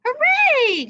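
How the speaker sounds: high, loud cry of delight; joyful cheer. A narrator's reading. Princess cheers as the frog returns her ball: high loud delight. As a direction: cheer like a girl getting her toy back. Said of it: "she cried".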